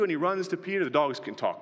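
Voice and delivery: ordinary talk, nothing marked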